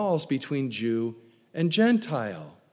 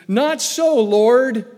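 high, loud cry of refusal; a startled, louder Peter objecting; loud protest; 7 semitones higher, 7 dB louder, much slower than usual. Acted. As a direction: push back hard at the Lord.

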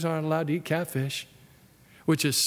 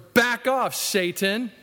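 stern rebuke; 6 semitones higher, 3 dB louder, slower than usual. Retold or imitated. Jesus rebuking Peter.

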